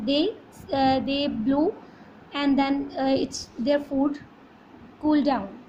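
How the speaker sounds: ordinary talk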